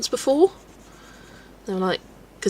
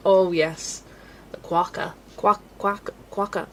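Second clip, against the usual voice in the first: put-on Australian accent; slower than usual. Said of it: "I'm trying to do an Australian accent and, uh, failing".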